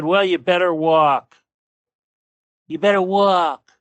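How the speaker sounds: mildly imitating Trump, drawn-out; lightly done Trump telling him to walk; Trump impersonation. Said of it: "my attempt at a Trump, uh, impersonation".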